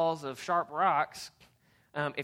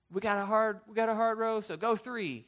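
high, loud urging command; urgent guide call; 8 semitones higher, 5 dB louder than usual. Retold or imitated. Raft guide urging the crew through a hard row, loud. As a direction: push the crew through the rapid.

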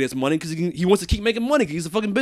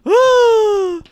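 teary sighs, higher pitched; choked up praise; tearful; 12 semitones higher than usual. Real, not acted. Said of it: "I'm tearing up".

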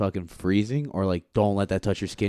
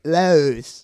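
Lewis with exaggerated Walsall vowel, higher; mock Walsall accent; 5 semitones higher, 4 dB louder than usual. Imitating a local giving a surname at registration, Walsall accent on "Lewis". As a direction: say "Lewis" like a local, barely intelligible. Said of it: "And they're like".

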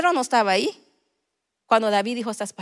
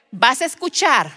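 preaching at full force, louder and higher; predicación fervorosa; 4 semitones higher, 8 dB louder than usual. Acted. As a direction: proclaim it to the congregation. Predicando a toda voz. (predicando a toda voz, más fuerte y más agudo)

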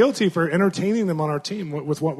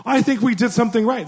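much higher, louder mom voice; the mom doubting the reconciliation; mother's doubt; 8 semitones higher, 4 dB louder than usual. Retold or imitated. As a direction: voice the skeptical mom up high. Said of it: "The mom's like".

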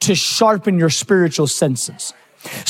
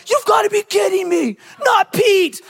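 put-on kids' disbelieving outcry; 11 semitones higher than usual. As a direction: cry out like a stunned kid who can't believe it. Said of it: "My boys were like".

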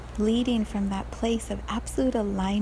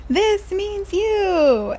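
put-on very high and very slow, drawn-out childish threat; little girl voice; 11 semitones higher, 5 dB louder, much slower than usual. Girl throwing her sister's toy, high slow childish warning. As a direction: stretch each word like a determined kid.

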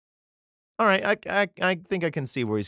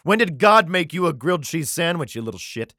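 loud, higher-pitched scornful challenge; mock indignant parent; 6 semitones higher, 8 dB louder than usual. Parent challenging a child God will raise, loud mock indignation. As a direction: taunt loudly, then drop on the last words. Acts out resentful parent to orphan.

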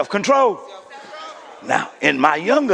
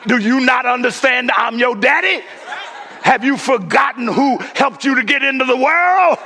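hammed-up threat to a mouthy son; mock angry father; 6 semitones higher than usual. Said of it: "my response would be".

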